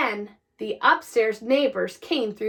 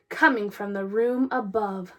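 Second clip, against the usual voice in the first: ordinary delivery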